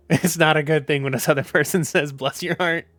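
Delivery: laughing through the words; a warning broken up by giggles; amused. Real, not acted. Warning outsiders about Southern politeness, amused and laughing. Laughing while explaining the insult.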